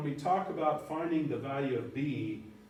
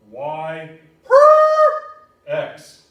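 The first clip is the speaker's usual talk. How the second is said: very slow, loud y per x; drawn-out emphasis; 3 semitones higher, 18 dB louder, much slower than usual. Shown as a demonstration. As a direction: drag it out at full voice. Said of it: "I can't say that enough".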